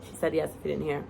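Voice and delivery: ordinary delivery, nothing marked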